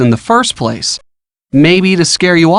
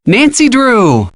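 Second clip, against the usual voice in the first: high, drawn-out teasing realization; playful, exaggerated "oh, it's you"; mock recognition; 5 semitones higher, much slower than usual. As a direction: stretch the name as if it just dawned. Feigns sudden recognition of caller.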